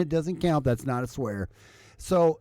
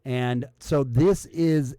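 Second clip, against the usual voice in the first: usual speaking voice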